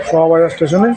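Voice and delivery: muffled, masked voice; through a face mask. Real, not acted. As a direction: speak through a mask over the mouth. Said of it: "mask পরে কথা বলছি". (মাস্কের ভেতর দিয়ে কথা)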